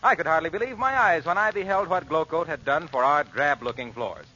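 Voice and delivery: usual speaking voice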